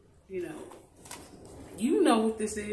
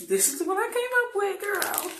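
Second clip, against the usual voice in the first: excited; 12 semitones higher, 6 dB louder than usual. Real, not acted. Excited reveal to viewer.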